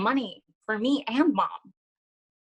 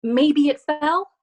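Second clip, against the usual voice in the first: high, slow, louder mom voice; higher, drawn-out motherly coaxing; 8 semitones higher, 7 dB louder, much slower than usual. Acted. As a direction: stretch it out as a hopeful suggestion to a child. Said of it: "she said".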